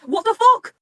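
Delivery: loud, high disbelief; shocked outburst. Real, not acted. Fan reacting to the threat on Mahiru, shocked.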